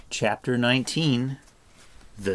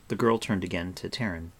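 usual speaking voice

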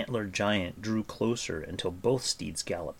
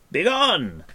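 high, loud command; shout; 10 semitones higher, 7 dB louder than usual. A narrator's reading. Bard ordering Taran to flee, shouting. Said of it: "the bard shouted to Taran".

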